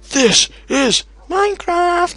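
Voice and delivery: high, slow, hammed-up battle cry; mock Sparta roar. Gamer parodying the Sparta shout about the cave. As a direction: ham up the movie war cry.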